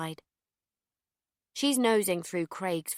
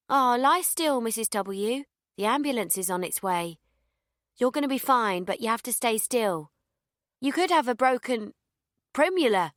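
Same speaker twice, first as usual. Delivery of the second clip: fake reassuring; 5 semitones higher, 5 dB louder than usual. A narrator's reading. Narrator fakes concern for victim.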